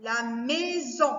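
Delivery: "La maison" slowly as La... mai... son; measured, separate beats in "La maison"; split into syllables. Shown as a demonstration. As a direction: draw out each syllable of "maison". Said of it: "deux syllabes".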